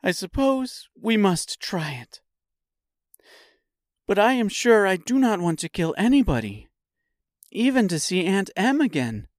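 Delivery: put-on high-pitched girl's voice; little girl giving in unwillingly; reluctant Dorothy. A narrator's reading.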